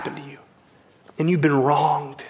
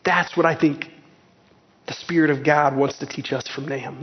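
ordinary delivery

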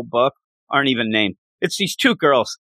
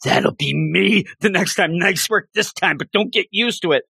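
mock dark rival; 5 semitones higher than usual. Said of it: "He gets real dark".

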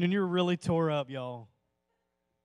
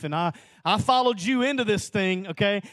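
raised voice, pleading with God; a rising plea that is starting to build; pleading complaint; 5 semitones higher, 8 dB louder than usual. Retold or imitated. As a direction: press the complaint upward. Arguing with the Lord, retold.

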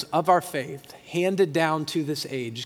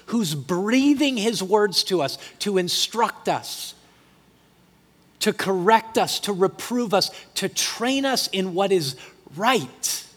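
louder, rising preacher's build; fervent preaching; 3 semitones higher, 3 dB louder than usual. Acted. Pastor preaching to the church about hope in grief, louder and fervent. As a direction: press the hope home to the congregation.

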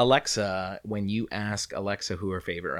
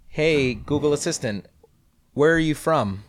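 higher, louder, clear command; assistant command; 7 semitones higher, 4 dB louder than usual. Shown as a demonstration. Co-host showing off Google Assistant, higher clear command. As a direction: call out to the phone clearly.

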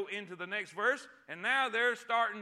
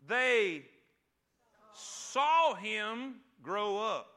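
slow, louder, rising preaching; preaching climax; 3 semitones higher, 5 dB louder, much slower than usual. Real, not acted. Preacher building toward his point to the church, slow and rising. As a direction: drag each phrase out, building higher and louder.

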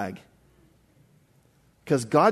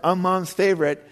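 put-on kid's voice; 5 semitones higher than usual. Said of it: "all my kids ask me that question".

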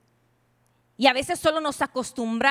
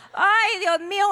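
higher-pitched exclamation of shock; mock dismay; 6 semitones higher, 4 dB louder than usual. Woman seeing the sister's face, mock fainting dismay. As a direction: react as if about to faint. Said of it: "uno cae muerta y desfallecida".

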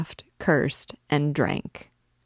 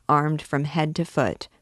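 usual speaking voice

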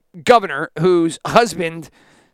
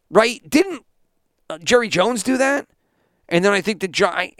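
normal talking voice

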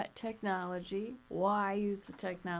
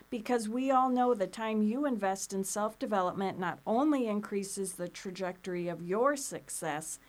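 podcast outro; 4 semitones higher, 5 dB louder than usual. Acted.

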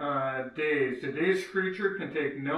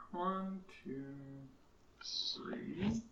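whispering; 4 semitones higher, 11 dB quieter, much slower than usual. Real, not acted. Counting squares under breath.

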